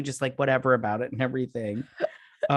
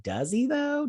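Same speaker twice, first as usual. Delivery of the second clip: high, doubtful question; skeptical; 12 semitones higher, slower than usual. Retold or imitated. Host doubting what the character said, skeptical. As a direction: raise an eyebrow with the voice.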